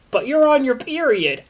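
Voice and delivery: high, loud hammed-up yell; mock scream. Will screams back at Christina, mock yell. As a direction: protest with a yelp. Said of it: "Will screamed".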